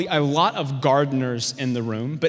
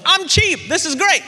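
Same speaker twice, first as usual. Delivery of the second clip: put-on much higher, louder, thrilled teen; excited; 12 semitones higher, 5 dB louder than usual. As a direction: squeal with bargain delight. Excited teen buying purses.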